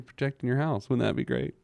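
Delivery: ordinary talk, nothing marked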